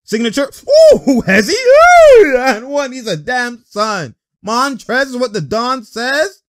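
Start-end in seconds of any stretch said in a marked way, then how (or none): 1.6-2.4 loud, high, drawn-out whoop; excited whoop. Real, not acted. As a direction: cry out in delight at the play. Whoop after a play.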